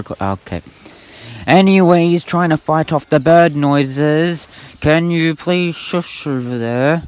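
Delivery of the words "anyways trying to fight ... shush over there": much higher and louder, slow and drawn out; raised over noise. Real, not acted. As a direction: call out slowly over the racket of the birds. Talking over loud bird noises.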